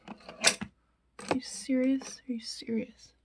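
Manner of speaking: whispered disbelief; exasperated whisper. Real, not acted. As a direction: hiss it under your breath in disbelief. Can't find her brush.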